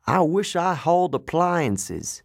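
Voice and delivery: drawn-out complaint from a trucker. Acted. Trucker moaning about hauling smelly animals, griping. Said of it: "one of the truckers said".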